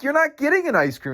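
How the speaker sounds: high-pitched retort, re-enacted; retold indignant refusal. Vendor to the guy who threw up, retold indignant refusal. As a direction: shoot back a high, disbelieving no.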